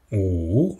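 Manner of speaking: "Ou" dipped low, with a round OU vowel. Shown as a demonstration. Teacher models "Ou" for students: low dipping tone. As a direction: keep "Ou" deep in the low tone. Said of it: "le ton bas".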